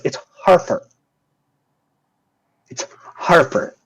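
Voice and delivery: "Harper" with a clear r in "Har"; correcting the name. Acted. Nurse corrects the guard on "Harper", pointedly. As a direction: set "Harper" apart, pronounced pointedly. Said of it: "And she goes".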